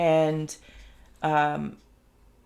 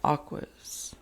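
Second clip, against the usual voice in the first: breathy whispered word Aquas; whispered aside; 5 dB quieter than usual. Real, not acted. Card named while shuffling.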